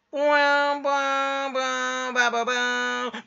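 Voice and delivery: high brassy fanfare made by mouth; mock quiz jingle. Streamer imitates the quiz sound effect, sung out high. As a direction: voice a drawn-out game-show sting.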